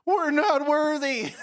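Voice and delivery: high, loud, slow groveling cry; bow down and wail it; worshipping minions. Retold or imitated. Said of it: "What if they did".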